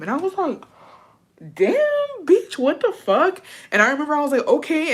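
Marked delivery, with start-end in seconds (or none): 1.5-3.4 high, slow, drawn-out indignation; shocked disbelief. Retold or imitated. Reacting to the teacher's telling-off, shocked. 4.5-4.9 high-pitched startled compliance; scared reply. Retold or imitated. Answering the teacher who yelled, scared. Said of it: "I got fucking scared".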